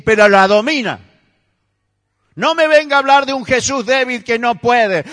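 loud, high, driving sermon; fiery preaching. Acted. Pastor rebuking believers who excuse their anger, fiery. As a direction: hammer the point home to the congregation.

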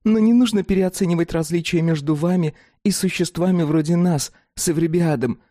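high, frank creature voice; dog-woman voice. Acted. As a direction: speak as a blunt dog-woman. Fatida explaining their nature.

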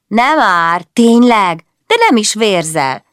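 put-on high, loud cheeky child comeback; cheeky snap back. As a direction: fire it straight back, teasing. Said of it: "mart vissza a kicsi azonnal". (a kisfiú szemtelenül visszavág)

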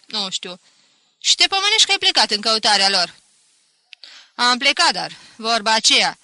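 loud, animated public complaint; loud storytelling. A narrator's reading. Pinto tells villagers of his stolen sheep, loud. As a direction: declaim it to the whole tavern.

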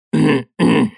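awkward throat-clearing; stalling little cough. A narrator's reading. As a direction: clear your throat to cover a pause. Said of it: "Марков закашлялся".

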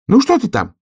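high, loud squirrel voice; high, insistent squirrel call. A narrator's reading. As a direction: call down a question in a high-pitched little voice. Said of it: "спросила из темноты белка".

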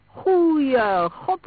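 high, loud alarmed exclamation; aghast shout; shocked cry. A narrator's reading. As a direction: cry out in horror, pitch jumping up. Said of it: "riep Story".

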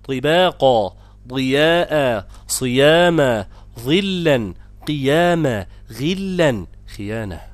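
measured list recitation, from طباقا to خيانة; slow drill from طباقا onward, long vowels drawn out; tajweed demonstration. Shown as a demonstration. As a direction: model each emphatic first letter with i, as in صياما. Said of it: "فكل هذه مفخمات مكسورة".

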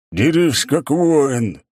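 loud muffled helmet roar. A narrator's reading. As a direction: bellow the challenge from inside a helmet. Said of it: "взревел приглушённый голос из-под шлема".

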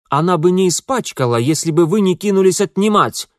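high, loud indignant woman. A narrator's reading. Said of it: "возмущался женский голос".

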